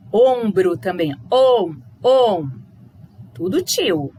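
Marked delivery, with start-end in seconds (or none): 1.3-2.5 om, om loud and humming through the nose; nasal om. Shown as a demonstration. Teacher sounding out the om in ombro. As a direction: hum the nasal om. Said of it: "pro colocou om".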